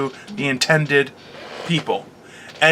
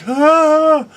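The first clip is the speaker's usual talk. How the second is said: mock surprise; 13 semitones higher, 5 dB louder than usual. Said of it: "And I'm just like".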